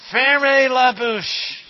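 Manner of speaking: loud, slow French command; mock teacher yell. French teacher yelling at the class to hush, loud and slow. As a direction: bark it like a strict French teacher. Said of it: "my French teacher always used to yell at us".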